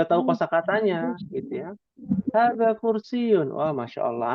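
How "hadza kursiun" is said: slow, higher, carefully spelled-out phrase; mother teaching child. Pretend. Mother pointing at a chair for her child, slow and clear. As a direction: say it slowly so the child learns. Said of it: "yang ditunjuk".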